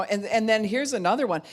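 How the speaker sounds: ordinary talk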